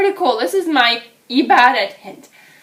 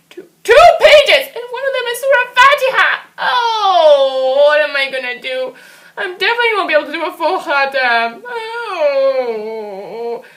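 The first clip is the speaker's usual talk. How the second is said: put-on wailing like a frustrated child; sobbing about falling behind on the khatam; crying over the pages; 3 semitones higher, 7 dB louder than usual. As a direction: voice breaking into tears, pitched up. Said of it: "Oh, don't cry".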